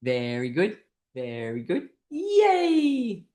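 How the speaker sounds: chanted praise into a high whoop; group cheer. Shown as a demonstration. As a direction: chant evenly, then burst into yay. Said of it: "Once more".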